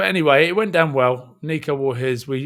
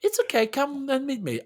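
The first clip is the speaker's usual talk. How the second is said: higher, easygoing Fijian accent imitation; Nico's Fijian accent; 13 semitones higher than usual. Quoting Nico reassuring him on the phone, Fijian accent mimicry. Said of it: "Your mimicry of, uh, Nico's voice and accent is just uncanny".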